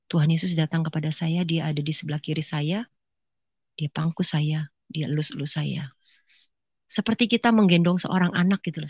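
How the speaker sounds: usual speaking voice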